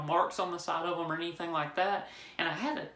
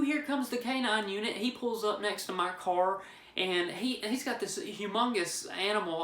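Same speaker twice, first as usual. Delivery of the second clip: ordinary talk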